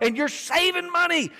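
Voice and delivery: high-pitched pretend excitement; playfully overexcited; mock shopper's glee. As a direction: pitch it up like a thrilled bargain hunter.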